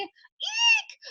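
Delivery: put-on tiny high-pitched girly eek; excited squeal. New recruit squealing to her viewers, delighted. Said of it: "And Molly's like".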